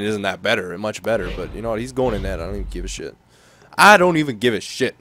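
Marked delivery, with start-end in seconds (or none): 2.4-3.2 low, soft throwaway; fed-up aside. Real, not acted. Player swapping goalies says it to himself, dismissive. 3.8-4.9 loud, higher, emphatic repeat; pissed off. Real, not acted. Player fed up with his goalie Rene, pissed off. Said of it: "I'm pissed off with Rene".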